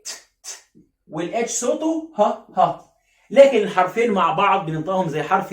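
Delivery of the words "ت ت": "ت" as a bare voiceless tap, twice; two soft, separate "ت" releases with no vowel; T sound. Shown as a demonstration. Teacher answering what T sounds like, voiceless isolated t. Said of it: "ال T صوتها إيه؟".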